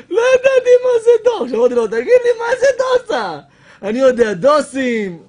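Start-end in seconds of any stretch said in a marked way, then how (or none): 0.1-3.4 laughing confusion. Retold or imitated. Retelling confused reply, laughing.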